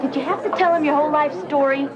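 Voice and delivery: annoyed. Acted. Daughter scolds mother after meeting.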